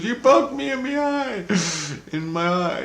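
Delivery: high mock wail; Julie screaming. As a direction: whine it high, mimicking a shriek. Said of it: "Julie's screaming at me".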